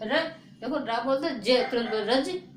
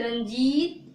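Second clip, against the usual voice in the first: Ranjit with जी stretched out; stressed long vowel; 4 dB louder, much slower than usual. Shown as a demonstration. Teacher demonstrating where force falls, Ranjit with long जी. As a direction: lean on the जी of Ranjit. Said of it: "Ranjit में थोड़ा जोर लगता है".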